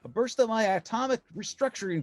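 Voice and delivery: high, bright heroic call; upbeat hero shouting encouragement; cheery young hero. Acted. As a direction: call down like an eager young superhero. Hero Firestorm calling down.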